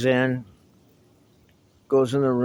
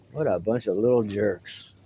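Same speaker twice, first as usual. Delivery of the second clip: ordinary talk, nothing marked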